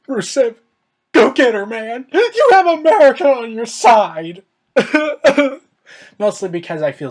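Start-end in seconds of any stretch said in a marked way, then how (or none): 0.1-4.4 high, loud rallying yell; loud fan shout urging a wrestler on; cheering shout. Retold or imitated. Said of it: "And I was like". 4.7-5.6 high burst of loud laughter; loud laughing burst. Real, not acted. Laugh after own shout.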